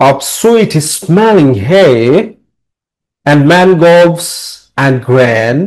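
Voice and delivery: very slow, measured recitation with drawn-out words; slow classroom verse recital with pauses; model poem reading. Shown as a demonstration. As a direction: read the poem aloud for students, stretching each phrase. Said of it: "এরকম ভাবে মানে কবিতাটি পড়তে বলছে".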